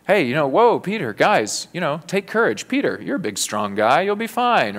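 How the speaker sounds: high, breezy buddy pep talk, hammed up; jolly them along like a cheerful coach; mock pep talk. Preacher mocks a hearty pep talk to Peter, high and breezy. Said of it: "He didn't say".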